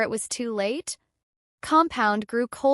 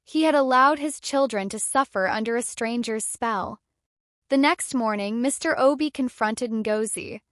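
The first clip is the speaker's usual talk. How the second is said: usual speaking voice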